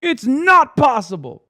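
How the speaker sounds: sudden high shout of a nihilistic douchebag; mock cynic shout. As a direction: bark it back like a furious cynic. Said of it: "nihilistic douchebag being like".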